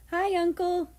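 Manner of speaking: put-on high, light little-girl voice. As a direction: sound like a small shy child.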